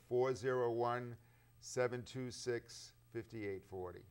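number read out slowly in separate groups; slow right down so it can be written down; slow dictation. Real, not acted. Repeats phone number for viewers.

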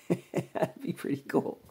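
chuckling through the words; amused by his own crazy idea; laughing. Real, not acted. Vlogger laughing at his veneer idea for viewers, chuckling.

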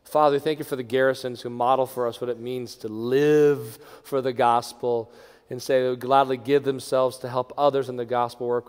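ordinary delivery

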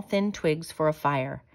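plain reading voice

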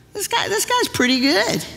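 impressed. Retold or imitated. Pete's inner thought.

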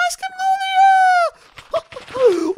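put-on squeaky high-pitched spider girl, slow and sweet; girly high spider girl voice. (çok ince sesli örümcek kız)

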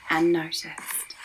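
breathy hush, almost no voice; whispered. A narrator's reading. Narrator whispering as Elmer sneaks away unseen. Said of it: "Elmer slipped quietly away".

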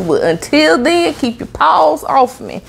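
firm warning. Real, not acted. Warning funeral staff off.

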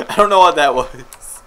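ordinary talk, nothing marked

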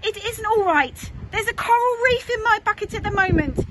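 indignant outburst, rising to loud and rushed; exasperated child. A narrator's reading. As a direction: protest crossly, hammering each ever. Child refusing the third request, exasperated.

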